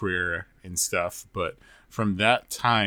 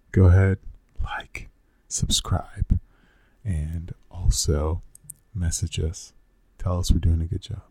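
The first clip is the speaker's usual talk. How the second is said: sexy voice; 2 semitones lower, 4 dB louder than usual. Pretend. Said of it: "I have my sexy podcasting voice on".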